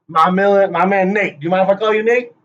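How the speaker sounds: usual speaking voice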